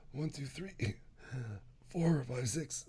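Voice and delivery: counting out of breath; soft, gasping count to six; breathless counting. Shown as a demonstration. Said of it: "Oh boy".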